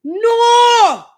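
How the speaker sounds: a sudden high, loud "No" in a mock yell; hammed-up high yelled No; shout. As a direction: imitate the stranger shouting her down. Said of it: "he's like".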